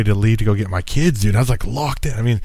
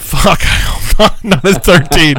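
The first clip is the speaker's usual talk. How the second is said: laughing; 8 semitones higher, 7 dB louder than usual. Real, not acted. Laughing about his son dating.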